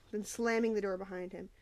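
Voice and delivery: ordinary delivery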